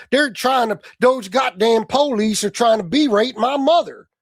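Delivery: mock Oklahoma accent. Host mocking Tony's word, exaggerated Oklahoma accent. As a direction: mimic an indignant Oklahoma yokel accent. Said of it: "Fucking Oklahoma?".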